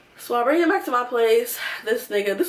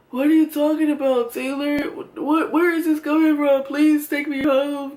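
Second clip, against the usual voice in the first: put-on higher, louder drunk protest; drunk whining; 5 semitones higher, 4 dB louder than usual. Drunk boyfriend begging his girlfriend to take him home, high whiny plea. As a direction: whine it like a drunk who wants to go home.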